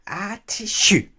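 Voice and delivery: high, loud, slow acted sneeze on the words; hammed-up storybook sneeze; sneezing. A narrator's reading. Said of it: "she sneezed".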